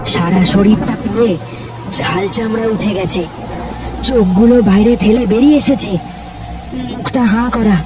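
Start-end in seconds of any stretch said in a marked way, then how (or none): none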